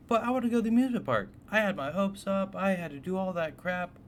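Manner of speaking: high, loud, fast rant; frustrated outburst. Retold or imitated. Telling viewers about the missed amusement park: high, fast, loud frustrated rant. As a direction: spill out the disappointment in a rush.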